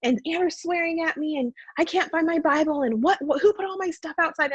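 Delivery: high, pleading yell at mom; retold upset scream. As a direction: scream it out, enraged. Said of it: "I'm just, like, screaming".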